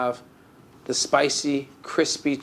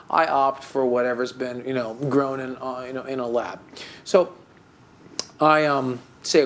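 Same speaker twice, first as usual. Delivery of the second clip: ordinary talk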